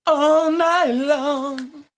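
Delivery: high, slow sung falsetto. Pretend. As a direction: stretch it out in falsetto. Joking about all-night dance battle.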